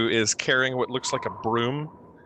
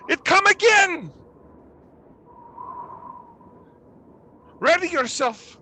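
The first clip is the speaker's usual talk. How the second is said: high-pitched cry of alarm; panicked screaming; 6 dB louder than usual. Acted. Villager warning the others inside, screaming in panic. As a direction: shriek a frightened warning. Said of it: "human sounds screaming".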